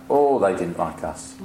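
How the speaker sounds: ordinary talk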